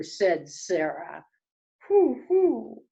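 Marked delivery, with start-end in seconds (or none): 1.9-2.8 high, loud double hoot; raised, carrying owl "hoo". Acted.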